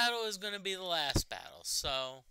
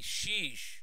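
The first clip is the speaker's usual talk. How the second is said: raised, falling groan of disbelief; exasperated; 4 semitones higher than usual. Real, not acted. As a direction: let out frustration, pitched high. Exasperated outburst at the game.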